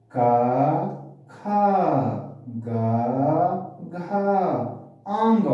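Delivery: का, खा, गा, घा, आंग said one by one, with pauses between; isolated syllables, का plain, खा aspirated, गा plain, घा aspirated, आंग nasal; careful pronunciation demo. Shown as a demonstration. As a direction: sound out का, खा, गा, घा, आंग, puffing breath on खा and घा. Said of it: "इसका शुद्ध उच्चारण क्या होता है".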